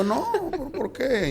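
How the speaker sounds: high-pitched incredulous why; retold disbelief. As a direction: squeak out a baffled question. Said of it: "Y yo".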